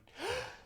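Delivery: sharp high intake of breath. Real, not acted. Deep breath before breath hold.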